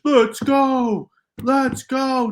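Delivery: loud, high parody of a celebration; mocking sports-bro yell; mock hype cheer. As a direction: imitate everyone yelling the same catchphrase. Mocking everyone's repeated catchphrase cheer.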